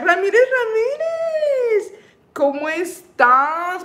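high, slow, stretched call of the name; sing-song greeting. Real, not acted. Host greeting a fan by name, high drawn-out sing-song call. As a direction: sing the name out to welcome them.

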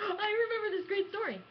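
gasping, high and loud, suddenly remembering. Pretend. Pretending to suddenly remember a story, gasping loudly. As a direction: burst out as if struck by the memory.

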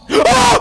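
sharp, high, loud gasp with a cry; startled cry-gasp; shocked gasp. Real, not acted. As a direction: gasp as if something jumped out. Sudden scare in cave.

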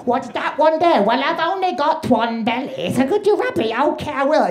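put-on drawn-out, babyish lovey-dovey talk; hammed-up squeaky baby talk; sickening baby voices. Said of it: "sickening baby voices that couples do".

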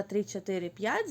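ordinary delivery

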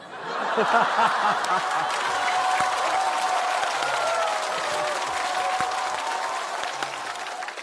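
long audience laughter; the room breaks up laughing; crowd laughter. Real, not acted. Audience reacts to impression.

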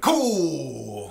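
grandpa's reaction. Retold or imitated. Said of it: "he went".

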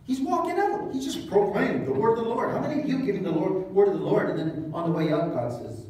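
ordinary talk

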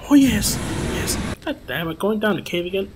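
ordinary talk, nothing marked

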